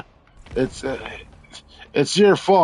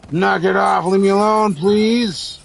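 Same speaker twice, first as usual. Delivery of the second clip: loud, higher pleading shout; exasperated yell; 6 semitones higher, 4 dB louder than usual. Real, not acted. Player begging enemies to stop, exasperated yell. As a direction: beg them to stop, loudly.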